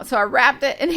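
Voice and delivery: normal talking voice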